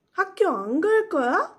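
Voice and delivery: 학교 안 갈 거야 loud and high, rising at the end; question intonation. Shown as a demonstration. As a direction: ask 학교 안 갈 거야 with a big final lift. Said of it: "read as questions".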